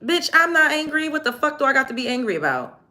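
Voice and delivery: high-pitched, indignant comeback; heated denial. Real, not acted. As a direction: protest hotly that you are not mad. Denies being angry, heatedly.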